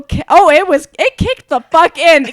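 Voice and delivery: ordinary delivery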